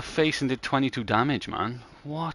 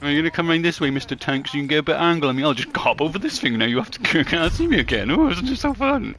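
higher, louder sing-song teasing; mock taunting; 7 semitones higher, 6 dB louder than usual. Gamer voicing himself taunting the tank player, sing-song mocking. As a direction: playfully taunt the stuck tank. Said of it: "it's like Tom and Jerry".

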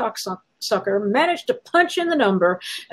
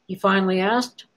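usual speaking voice

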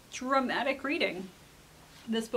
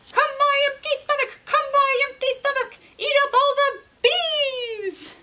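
high, loud rooster chant; rooster calling out; 13 semitones higher, 6 dB louder than usual. Acted. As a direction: summon the magic stomach like a spell. Rooster calls on his stomach.

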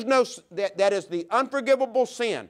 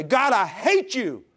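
slow, anguished outburst at God; grieving cry; 4 semitones higher, 3 dB louder, slower than usual. Acted. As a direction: drag it out in bitter grief. Grieving child cries at God.

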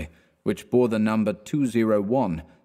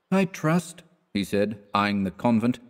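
put-on higher, prim old clergyman voice; stern reverend; 7 semitones higher than usual. A narrator's reading. Reverend warns landlady about immorality, prim and raised. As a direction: lecture the landlady primly. Byram voice again.

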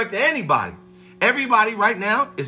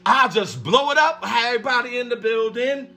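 ordinary delivery

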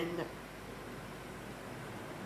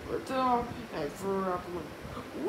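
ordinary delivery

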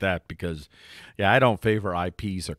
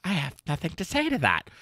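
snooty retort; 6 semitones higher, 5 dB quieter than usual. Retold or imitated. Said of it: "she just looks at him and she says".

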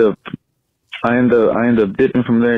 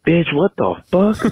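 higher, slow indignant bitch what the fuck; slow outraged disbelief; 7 semitones higher, much slower than usual. Retold or imitated. To his ex who won't let him come, slow outraged disbelief. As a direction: stretch it out in disbelief.

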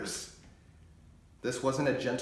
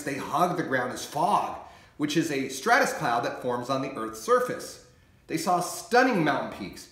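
ordinary delivery, nothing marked

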